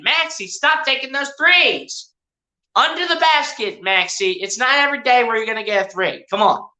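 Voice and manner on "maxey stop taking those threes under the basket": exasperated scolding. Real, not acted. Commentator scolds a player who keeps missing threes, exasperated. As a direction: plead with the player in pitched-up frustration.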